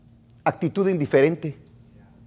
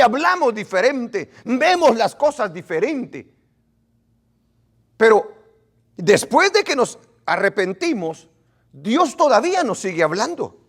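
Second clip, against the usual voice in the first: usual speaking voice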